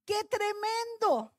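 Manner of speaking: put-on high, drawn-out childlike amazement; retold wide-eyed wonder in a high voice; surprised little daughter. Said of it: "Albita se sorprendió y dijo".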